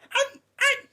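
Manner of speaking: very high, loud mock shrieks; imitando los quejidos. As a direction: shriek like the possessed one, hammed-up. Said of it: "y el otro decía". (alaridos agudos de poseído, imitados)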